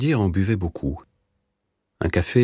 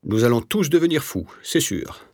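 pleasant, higher, light voice; amiable tone; 8 semitones higher than usual. A narrator's reading. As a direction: say madness cheerfully, pitched up. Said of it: "sur un ton aimable". (ton aimable, voix plus haute)

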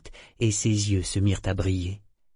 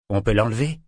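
brusquement; 3 dB louder, faster than usual. A narrator's reading. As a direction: question lâchée d'un coup, sans détour. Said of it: "demanda-t-il brusquement". (le pirate interroge Eta brusquement, ton abrupt)

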